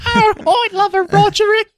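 very high, hammed-up Norfolk lass; Norfolk accent. Answering the GI's date offer, falsetto Norfolk lass. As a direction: squeal it like a swooning Norfolk girl. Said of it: "I don't know if that's a Norfolk accent".

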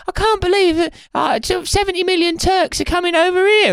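very high voice of a worried woman; woman voter. Retold or imitated. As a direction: squeal it like an alarmed woman. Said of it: "she's like".

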